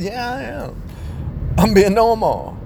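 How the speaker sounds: very high, strained mock protest; husband pleading he's normal, very high; imitating himself. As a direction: squeak out the protest. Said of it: "I said".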